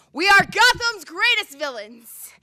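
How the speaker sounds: loud, high, slow villain's boast; ringing, drawn-out declaration; grand proclamation. Acted. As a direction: proclaim it to the whole city. Poem proclaims the villains' identity.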